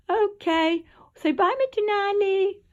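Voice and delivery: high and loud cooing to the cat; pet talk. Real, not acted. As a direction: get the cat to wave bye, sing-song. Making cat say bye.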